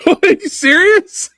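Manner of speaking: high, slow, wide-eyed are you serious; amazed disbelief. Real, not acted. Host reacting to a ridiculous fan review, astonished. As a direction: stretch it out in sheer disbelief. Hearing about the fake-accent review.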